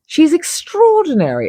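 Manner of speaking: quoted photographer arguing her case, insistent and high; insistent argument. Retold or imitated. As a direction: voice the photographer defending her, emphatic. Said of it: "Avedon argued".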